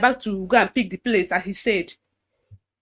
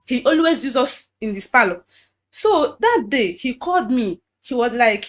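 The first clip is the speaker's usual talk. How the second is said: usual speaking voice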